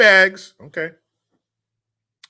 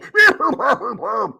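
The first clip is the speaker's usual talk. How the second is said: high laugh; 12 semitones higher, 8 dB louder than usual. Real, not acted. Laughs after his accent.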